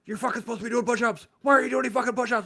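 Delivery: furious drill sergeant bawling out a recruit; angry drill instructor. Retold or imitated. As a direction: yell at the recruit on the ground. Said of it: "knife handed me".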